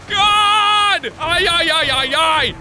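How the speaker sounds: high, loud panicked yelling; frantic high-pitched outcry; panicked shout. Real, not acted. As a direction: yell in alarm as it all goes wrong. Panicking at a game crash.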